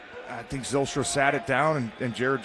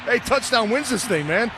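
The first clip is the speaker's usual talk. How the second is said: raised, urgent pep talk; rally the team before the big drive; fired-up commentator; 10 semitones higher than usual. Real, not acted. Color commentator pumping up the team.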